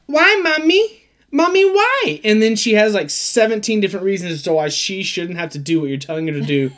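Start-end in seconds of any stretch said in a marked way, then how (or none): 0.1-2.2 put-on high, pleading little-girl whine; high whiny kid voice asking why; whiny child. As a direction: imitate a whining toddler. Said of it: "and she's like".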